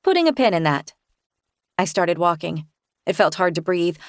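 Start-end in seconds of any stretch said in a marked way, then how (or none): none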